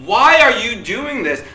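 high, loud, exasperated protest; mad. Acted. Preacher admitting anger like Susie, exasperated. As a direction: act out indignation, stretching the final question. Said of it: "I get mad".

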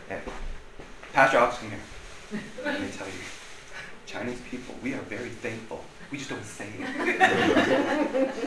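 imitating a quiet private correction; hushed confiding. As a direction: take someone aside, very softly. Retold private reproof after being pulled away.

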